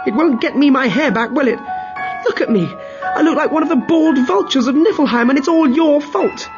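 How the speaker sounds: furious shout. Acted. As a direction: berate your husband at the top of your voice. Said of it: "shouted at her husband".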